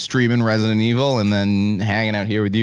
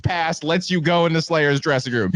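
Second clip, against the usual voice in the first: high, emphatic parody of official backstage rules; mock rule recital; 11 semitones higher than usual. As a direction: list the rules like a strict bouncer, with mock gravity.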